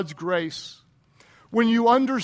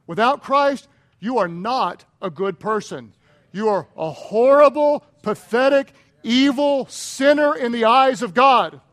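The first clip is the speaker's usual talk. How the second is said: louder, hard-hit condemning preaching; forceful preaching; 3 semitones higher, 7 dB louder than usual. Real, not acted. Preacher telling the congregation they are sinners, forceful. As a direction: drive each damning word home.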